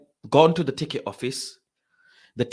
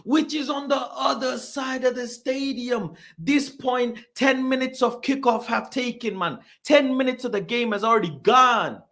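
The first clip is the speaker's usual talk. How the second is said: high, incredulous complaining; exasperated rant; 10 semitones higher than usual. Retold or imitated. Fan missing kick-off rants about the stadium, exasperated. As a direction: pile up grievances in a raised pitch.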